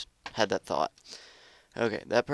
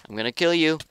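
higher, louder playful threat; hyped threat; 7 semitones higher, 6 dB louder, faster than usual. Acted. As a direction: psych yourself up as you say it. Building threat at opponent.

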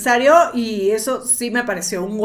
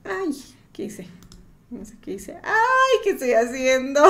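laughing; 3 semitones higher, 4 dB quieter than usual. Real, not acted. Panicked over broken audio.

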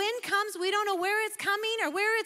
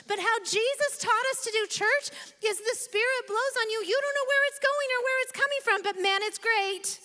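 higher, louder, carried away preaching; fired-up preaching; 6 semitones higher, 3 dB louder than usual. Real, not acted. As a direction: let the excitement run over the crowd. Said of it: "I'm a lot today".

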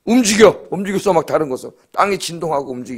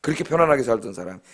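ordinary talk, nothing marked

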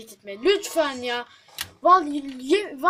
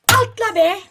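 loud, higher desperate command; pleading yell; 8 semitones higher, 14 dB louder than usual. Real, not acted. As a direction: yell at the screen to jump. Begging character to jump.

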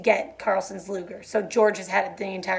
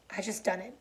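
much softer, worn out; tired; 12 dB quieter than usual. A narrator's reading. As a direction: barely get it out. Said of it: "George said tiredly".

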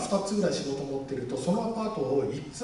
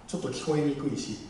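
usual speaking voice

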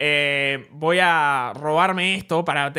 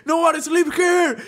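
mock yell in English, a bit higher and slower; 8 semitones higher, slower than usual. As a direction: replay the wake-up yell, lighter, drawn out. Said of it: "el [name] gritando así".

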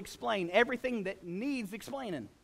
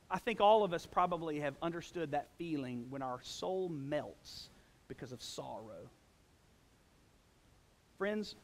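ordinary talk, nothing marked

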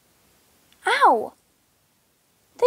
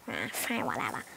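much lower, grudging cat voice; weird cat voice; 14 dB quieter than usual. Pretend. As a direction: give in grumpily as the cat. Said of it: "Tofu has, like, a weird voice".

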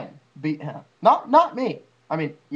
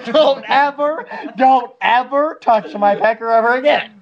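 frat bro; 6 semitones higher, 8 dB louder than usual. Pretend. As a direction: bark it like a drunk bro. Mock tough warning.

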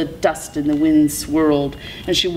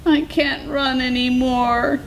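wailing Coyote; 8 semitones higher than usual. A narrator's reading. Exhausted Coyote protests in story.

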